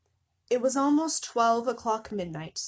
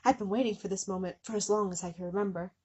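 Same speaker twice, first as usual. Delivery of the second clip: plain reading voice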